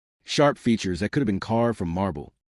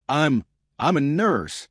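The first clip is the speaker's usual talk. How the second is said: slow, higher, halting nervous stammer; 6 semitones higher, slower than usual. A narrator's reading. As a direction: stumble over the words, rattled. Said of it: "Chica stammered".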